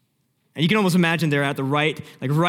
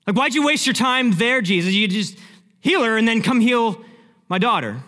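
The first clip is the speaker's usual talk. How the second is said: raised, louder exasperated complaint; acted frustration; 6 semitones higher, 5 dB louder than usual. Jairus reproaching Jesus for wasting time, frustrated and raised. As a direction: protest in frustration, pitch pushed up. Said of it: "and the frustration".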